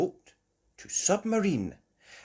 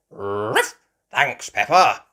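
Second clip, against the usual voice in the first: loud bark, then hearty thanks; dog voice; 10 dB louder, slower than usual. Acted. Grandad Dog thanking Peppa, barking voice. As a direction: bark and speak up.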